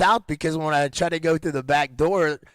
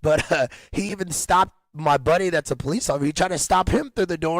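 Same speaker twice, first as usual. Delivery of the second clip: normal talking voice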